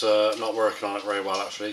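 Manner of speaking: normal talking voice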